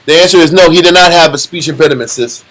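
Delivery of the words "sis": ordinary talk, nothing marked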